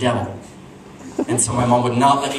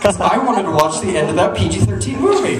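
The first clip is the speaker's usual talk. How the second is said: loud, raised punchline; indignant outburst; 6 semitones higher, 5 dB louder than usual. Acted. Comedian explaining why he drugged family, loud indignation. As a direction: burst out in comic self-justification.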